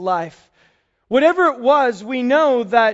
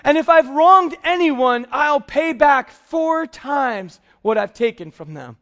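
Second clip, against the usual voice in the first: higher and louder, a joyful outpouring; overwhelmed with joy; 5 semitones higher, 4 dB louder than usual. Retold or imitated. Tax collector overflowing with joy as he answers Jesus, joyful. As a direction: let the joy burst out as he gives half away. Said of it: "just sense of joy that he feels".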